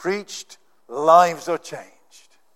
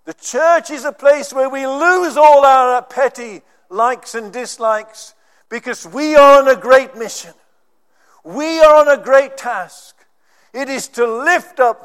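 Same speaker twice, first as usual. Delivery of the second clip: loud, high, ringing preacher's call; preaching at full force; 7 semitones higher, 8 dB louder than usual. Acted. Preacher rallying his congregation, in a loud, lifted preaching voice. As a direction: proclaim it to the whole hall.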